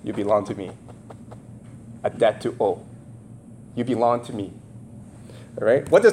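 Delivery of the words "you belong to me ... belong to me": low, soft menacing godfather voice; quiet low Godfather threat; Don Corleone. Retold or imitated. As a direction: murmur it low like a mob boss. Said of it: "Don Corleone would say".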